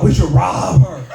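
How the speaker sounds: loud, stretched "rob" in "We should rob her"; imitating sister. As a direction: mimic sister leaning hard on "rob". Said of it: "She said it just like that".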